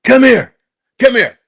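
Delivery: high, loud summons; imitated yelled order. Golf tough ordering the young player over to him, yelling. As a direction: holler a repeated command, high and forceful. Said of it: "He yells louder".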